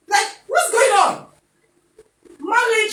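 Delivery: high, slow, loud puzzled voice of a new wife; confused bride. Retold or imitated. New wife wondering if in-laws like her, high puzzled cry. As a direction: play the baffled wife asking what's wrong.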